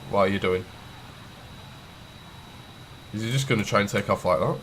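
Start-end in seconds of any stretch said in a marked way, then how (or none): none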